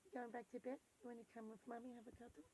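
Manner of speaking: very soft, fading to almost nothing. Real, not acted. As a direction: murmur tenderly so she stays sleepy. Mother talks to sleepy child.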